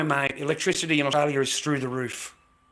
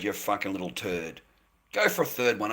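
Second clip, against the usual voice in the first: usual speaking voice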